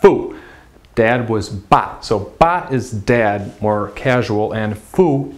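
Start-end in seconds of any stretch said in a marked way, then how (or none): 0.0-0.4 FU loud, falling sharply from high; sharp drop on FU; fourth tone. Shown as a demonstration. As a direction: punch out a falling FU. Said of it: "fourth tone".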